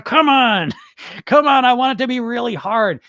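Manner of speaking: high, loud mock whining; hammed-up impatient dieter begging for punishment; demanding dieter. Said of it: "'cause you dieters, you want".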